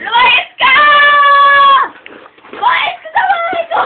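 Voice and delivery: loud, very high shrieked order; over-the-top pretend eviction; mock furious shout. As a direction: shriek as if throwing him out. Acted quarrel, throwing Ichiro out.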